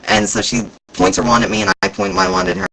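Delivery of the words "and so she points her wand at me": usual speaking voice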